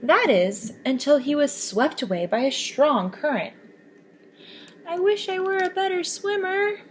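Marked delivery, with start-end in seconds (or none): 4.9-6.8 higher, wistful little fish voice; a hopeful little sea creature's wish; Kelp wishing. Acted. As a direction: sigh it out as a small sea creature.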